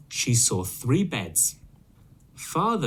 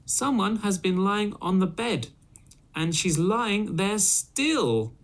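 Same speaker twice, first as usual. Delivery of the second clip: put-on little baby voice; 9 semitones higher than usual. Baby Bear finding Goldilocks in his bed, high baby cry. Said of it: "he cried in a little baby voice".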